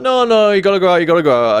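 high-pitched, pushy retold insistence; mock insisting. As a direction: imitate a mum nagging someone into going out. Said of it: "she worked me down and worked me down".

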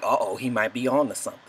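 low, soft, quick worry; hushed uh-oh. Retold or imitated. Viewers worrying about the brother, low hushed aside. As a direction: murmur it fast like a viewer. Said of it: "so then we like".

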